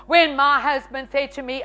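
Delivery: ordinary talk, nothing marked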